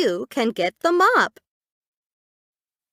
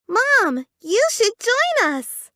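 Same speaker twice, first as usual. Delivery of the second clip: playful invite; 11 semitones higher, 3 dB louder than usual. Acted. Child invites mom playfully.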